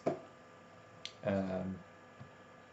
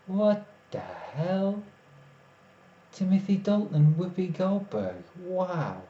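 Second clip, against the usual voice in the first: astonished; 8 semitones higher, slower than usual. Real, not acted. Surprised by a chat fact.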